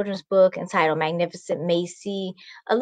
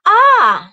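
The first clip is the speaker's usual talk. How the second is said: high, loud startled cry; a frightened girl's shriek; shocked scream; 12 semitones higher, 10 dB louder than usual. Acted. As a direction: scream at the stranger in the mirror. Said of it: "shouted Macy".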